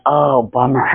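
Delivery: low, deflated groan of sympathy; dejected drop in pitch; let-down reaction. Acted. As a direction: sink down low in disappointment. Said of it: "you're like".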